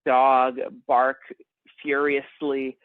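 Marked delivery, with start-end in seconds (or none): none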